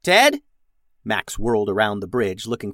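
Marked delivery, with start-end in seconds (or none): 0.0-0.4 high, loud questioning call; loud searching shout. A narrator's reading. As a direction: call for someone missing. Captain calls for missing crewman.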